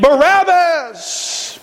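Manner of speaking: high, drawn-out mock crowd cry; crowd's scream. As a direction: call it out long and high like a screaming mob. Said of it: "they all screamed".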